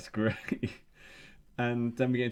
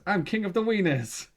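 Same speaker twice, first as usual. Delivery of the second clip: mock shout; 10 semitones higher, 5 dB louder than usual. Ted jumps out at lunch lady.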